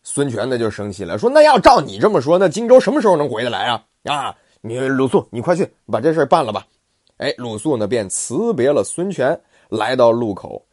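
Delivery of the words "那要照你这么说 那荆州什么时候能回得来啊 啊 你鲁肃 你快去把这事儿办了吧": 响亮急促的催逼; 不耐烦地赶人去办事; 孙权生气. Acted. 孙权生气催促. (孙权催鲁肃，急而响亮)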